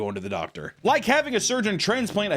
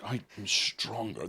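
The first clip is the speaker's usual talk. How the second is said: mock strongman; 7 semitones lower, 9 dB quieter than usual. Patient with chimp parts.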